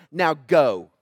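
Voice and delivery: low, firm command; stern parental order. Retold or imitated. Preacher voicing God ordering Moses off, low stern command. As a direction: give the order like a parent out of patience. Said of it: "that's like using your middle name".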